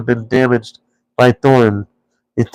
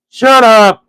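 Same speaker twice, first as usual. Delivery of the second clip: high, loud troll snap; two trolls silencing the third; angry trolls; 10 semitones higher, 5 dB louder than usual. A narrator's reading. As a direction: bark it at the voice. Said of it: "said they".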